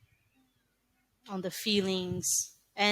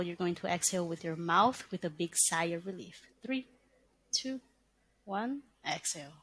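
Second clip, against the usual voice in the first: guided breathing; 4 dB quieter than usual. Shown as a demonstration. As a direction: talk them through each breath calmly.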